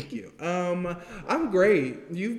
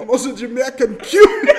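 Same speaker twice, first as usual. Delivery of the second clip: loud, high mock Southern-Jamaican accent, laughing; muddled Southern-Jamaican accent, breaking up; Marie Antoinette; 6 semitones higher, 12 dB louder than usual. Mimicking the co-host's heritage, loud mashed-up Southern-Jamaican accent. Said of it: "very Jamaican parent and a very Southern parent".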